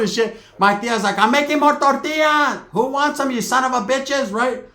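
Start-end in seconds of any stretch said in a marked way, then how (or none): 1.2-4.3 much higher, loud mock holler; hammed-up high-pitched auntie shout; aunt calling out. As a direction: yell it across the yard like a feisty aunt.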